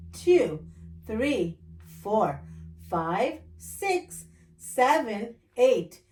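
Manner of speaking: ordinary delivery, nothing marked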